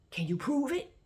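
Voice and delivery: high, challenging question; indignant. Retold or imitated. Imitating him challenging her for proof, indignant. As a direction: pitch it up like an affronted challenge. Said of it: "he gets indignant".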